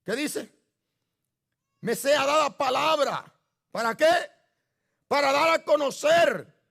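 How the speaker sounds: fiery pulpit preaching. Acted. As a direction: preach it out to the congregation with force. Preacher exhorting the church.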